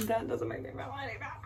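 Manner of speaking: soft, quick, through a laugh. Real, not acted. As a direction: laugh at yourself as you repeat it. Laughing at herself after outburst.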